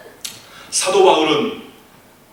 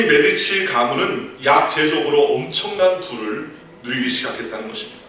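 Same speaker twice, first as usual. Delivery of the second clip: louder preaching, reading the verse out with weight; full-force preaching; 3 semitones higher, 3 dB louder than usual. Acted. Pastor reading Philippians aloud to the congregation, preaching at full force. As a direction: proclaim the scripture to the whole congregation. Preaching about the Medici effect.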